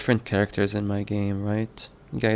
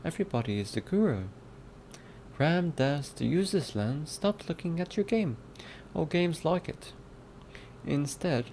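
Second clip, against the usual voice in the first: put-on British accent; 3 semitones higher, 3 dB quieter than usual. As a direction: read it out in an amateur British accent. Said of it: "use a different British accent".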